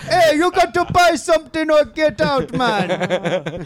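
high, loud mock Jamaican shopkeeper; exaggerated Jamaican accent. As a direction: order them out in a hammed-up Jamaican accent. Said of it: "they have Jamaican accents".

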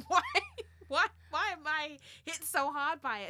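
loud, high, laughing through the question; laughing disbelief. Real, not acted. Laughing at herself for sobbing at a stranger's story, loud and high. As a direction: laugh at yourself as you ask it. Laughing at her own crying.

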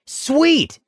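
high, thrilled little yelp; excited kid. Retold or imitated. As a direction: cheer it like a kid who got his wish. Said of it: "the kid says with a little fist pump".

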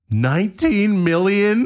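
gasping high disbelief; mock shock. Sam seeing his subscriber count, gasping in disbelief. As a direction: react stunned, drawn out.

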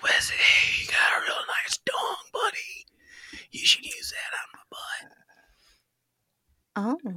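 mock ASMR porn whisper in a hillbilly voice. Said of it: "I turned in, like, a hillbilly".

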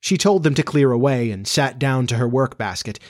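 plain reading voice

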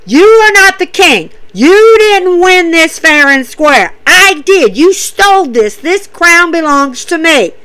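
angry king. Retold or imitated. Corbin telling Shinsuke he stole the crown, loud and angry. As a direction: scold him like a wronged king. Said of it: "Baron Corbin's standing there holding the crown".

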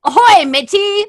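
loud, high pirate "Ahoy, matey!"; pirate accent. Shown as a demonstration. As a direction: boom it out like a pirate. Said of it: "Not like that".

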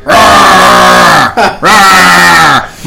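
high, loud mock growl; mock growling roar. Mocking the band's live vocals with a loud growl. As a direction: bellow like a bad death metal singer.

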